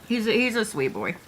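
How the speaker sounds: ordinary delivery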